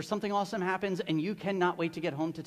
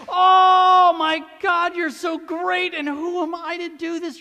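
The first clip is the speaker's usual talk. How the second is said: awed praise; 10 semitones higher, 14 dB louder, slower than usual. Retold or imitated. Voicing David's awed praise.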